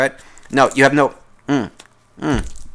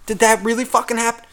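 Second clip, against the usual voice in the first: higher, overdone mock astonishment; hammed-up fake surprise; 8 semitones higher than usual. Listener mocking a coworker's work story with hammed-up surprise. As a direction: pile on the fake shock. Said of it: "people that act surprised".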